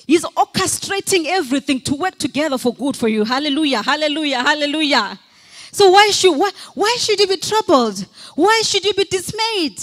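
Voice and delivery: loud, rising preacher's cry, stretching each line; preaching at full force. Acted. Pastor firing up the church, full-force preaching. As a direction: preach it hard and lift the room.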